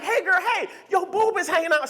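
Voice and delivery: put-on high, bubbly girl-friend voice, laughing; girlfriend voice. Woman warning her friend in the bar, high laughing girl voice. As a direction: play the excited bar girlfriend.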